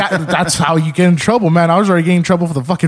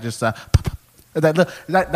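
usual speaking voice

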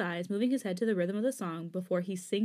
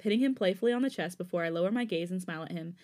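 ordinary delivery

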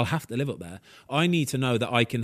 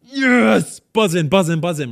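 much higher, louder yes; excited cheer; 7 semitones higher, 7 dB louder, slower than usual. Retold or imitated. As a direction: cheer like you've just won. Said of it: "instead of me going".

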